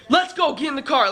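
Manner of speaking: high-pitched urgent command; imitated bossy, hyped-up call; retold hyped-up order. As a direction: replay yourself rushing everyone out. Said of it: "I got all hyped up".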